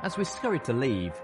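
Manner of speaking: usual speaking voice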